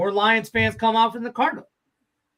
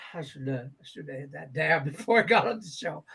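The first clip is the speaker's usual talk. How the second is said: ordinary talk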